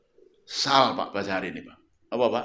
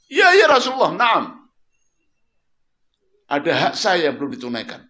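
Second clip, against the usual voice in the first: eager claim; 6 semitones higher, 11 dB louder, faster than usual. Retold or imitated. Man eagerly claims his right.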